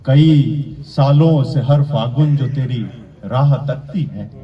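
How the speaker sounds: measured declamation with pauses between phrases; mushaira-style verse recitation; poetry recital. Acted. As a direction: recite the couplet to an audience, landing each phrase.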